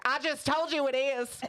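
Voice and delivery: insistent Southern lady impersonation; Southern accent. Retold or imitated. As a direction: imitate an indignant Southern customer. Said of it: "I did the accent".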